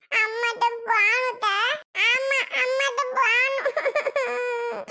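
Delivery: put-on high child voice breaking into sobs; crying child. Child pleading with aunt to go to mother, crying. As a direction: whine tearfully, drawing the words out.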